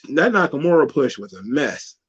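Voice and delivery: ordinary talk, nothing marked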